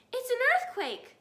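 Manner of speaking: high, loud alarmed cry; cried out. A narrator's reading. Missus Fox crying out to her family. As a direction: panic at the shaking ground. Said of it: "cried Missus Fox".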